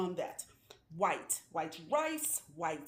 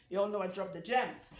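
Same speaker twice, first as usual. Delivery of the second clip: ordinary talk, nothing marked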